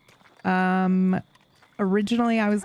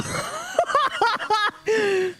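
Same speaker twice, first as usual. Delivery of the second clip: shrieking laugh. Real, not acted. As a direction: burst into a shrill laugh of shock. After being attacked.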